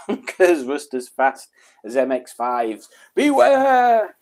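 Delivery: dramatic reading swelling into a loud wail; mock warning shout. Streamer reads a viewer's car warning, ending in a mock shout.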